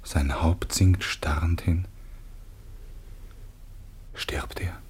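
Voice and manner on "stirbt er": a scared whisper over the dying father; hushed, fearful whisper; frightened whisper. Acted. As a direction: breathe it, barely audible. Son fears father is dying.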